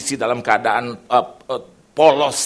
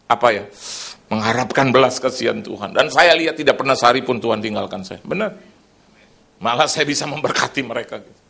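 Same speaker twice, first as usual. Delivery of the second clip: usual speaking voice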